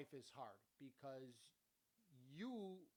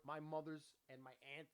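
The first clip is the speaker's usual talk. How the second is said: normal talking voice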